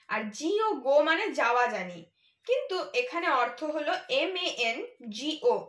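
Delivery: normal talking voice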